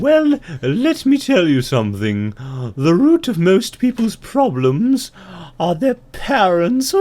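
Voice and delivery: high, loud, drawn-out owl voice; wise owl. Acted. Owl counselling the ant, high loud hooting voice. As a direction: pronounce it slowly as a hooting owl. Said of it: "And the owl says".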